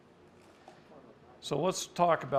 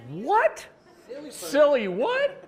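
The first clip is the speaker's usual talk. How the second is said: much higher, louder, slow puzzled question; mock confusion; 11 dB louder, much slower than usual. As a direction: sound baffled by a strange word. Mishearing syllogism in retold scene.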